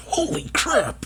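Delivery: high, soft, slow cry of shock; shocked exclamation. Real, not acted. Viewer stunned by the estimate, high shocked cry. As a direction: let the shock squeak out.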